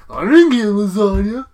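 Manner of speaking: put-on whining brother impression; Brad Garrett. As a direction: moan like the left-out sitcom brother. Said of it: "Brad Garrett would be like".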